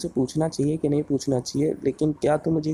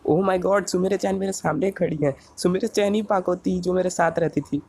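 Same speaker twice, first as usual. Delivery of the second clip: higher-pitched, awed fan; starstruck amazement; 6 semitones higher than usual. Acted. Fan sees his idol standing before him, starstruck amazement. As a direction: gush in amazed disbelief.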